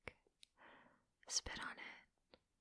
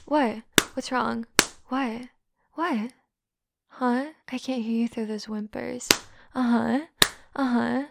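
louder, feigned innocent teasing; mocking taunt; 8 dB louder than usual. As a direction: ham up the fake concern.

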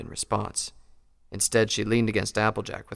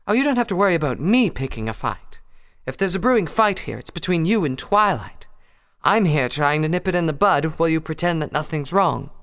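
lightly higher refined mare; Rarity voice; 8 semitones higher, 6 dB louder than usual. Acted.

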